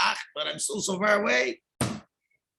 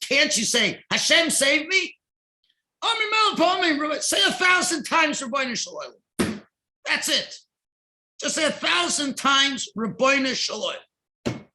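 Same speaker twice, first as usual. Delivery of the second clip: urgent scolding; 7 semitones higher than usual. Retold or imitated. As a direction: press him hard to just cry out. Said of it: "he scolded him".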